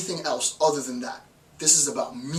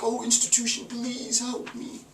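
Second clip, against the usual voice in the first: higher, soft, breathy whispered plea; mock whimpering beggar; 8 semitones higher, 3 dB quieter than usual. Student begging the institution, mocked in a high soft whimper. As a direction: whine feebly for help. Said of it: "suppose you're like".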